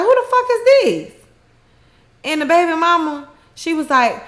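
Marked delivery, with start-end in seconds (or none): 0.0-1.1 shocked. Retold or imitated. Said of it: "they looking like".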